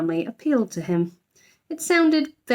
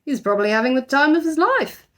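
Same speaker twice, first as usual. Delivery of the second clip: grumbling Mr Brown; 6 semitones higher than usual. A narrator's reading. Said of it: "grumbled Mister Brown".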